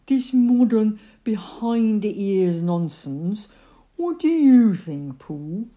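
drawn-out disgruntled complaint; grumbling. A narrator's reading. Eeyore complains to Pooh about washing, grumbling. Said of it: "grumbled Eeyore".

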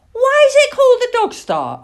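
high, loud little owl voice; curious owlet. Acted. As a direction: ask eagerly in a piping voice. Plop curiously questions the man.

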